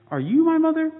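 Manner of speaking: a tiny chick's voice pleading; high baby bird. Acted. Baby bird asks the hen.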